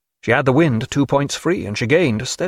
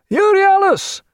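loud, very high shout; returning hail; 5 dB louder, slower than usual. A narrator's reading. Strange ship answering the challenge, loud high hail. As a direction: hail it out across the water. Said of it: "he heard the returning hail".

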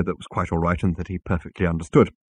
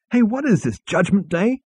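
high sharp snap; snapped; 12 semitones higher than usual. A narrator's reading. Zaphod snaps at Ford under the table, sharp. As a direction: bite the words off. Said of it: "snapped Zaphod".

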